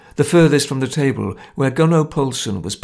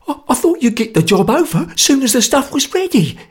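stammering fear; 13 semitones higher, 4 dB louder than usual. A narrator's reading. Frightened conspirator stammering.

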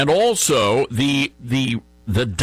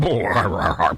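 stifled laugh; 8 semitones lower than usual. Real, not acted. Laughing at quote.

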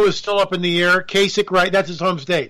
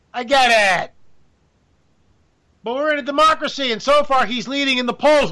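higher, exasperated rant; indignant; 6 semitones higher than usual. Real, not acted. Host ranting to listeners about the Trump polls, higher exasperated voice. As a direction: pitch it up in disbelief.

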